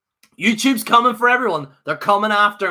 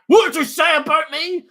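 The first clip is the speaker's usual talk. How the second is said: mock Brock threat; 7 semitones higher than usual. Brock answering Vince.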